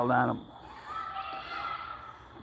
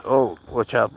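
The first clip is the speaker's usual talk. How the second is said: ordinary talk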